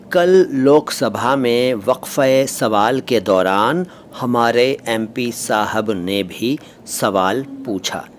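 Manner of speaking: slow, deliberate teacher's example; drawn-out demonstration sentence. Shown as a demonstration. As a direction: dictate it word by word, slowly.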